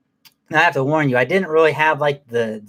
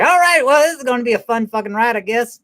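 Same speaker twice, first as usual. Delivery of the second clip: amused eager; 9 semitones higher than usual. Retold or imitated. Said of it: "And I was like".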